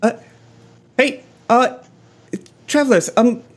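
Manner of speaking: timid call for attention, with pauses; hesitant traveler. Acted. Stranger hailing the party for help.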